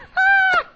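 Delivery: shrieking burst of laughter; loud high cackle. Shown as a demonstration. Preacher showing the loud laugh Sarah did not do. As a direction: let out a big shrieking laugh. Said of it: "It wasn't like that".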